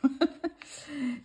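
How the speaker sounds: short self-mocking laugh; amused laugh. Real, not acted. Vlogger joking about their English accent, amused laugh. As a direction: laugh at your own clumsy accent.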